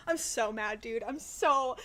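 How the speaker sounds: ordinary talk, nothing marked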